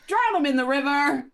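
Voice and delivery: high, loud mock accusation; hammed-up mob shout. Friends mock a crowd hunting a witch, hammed-up high shout.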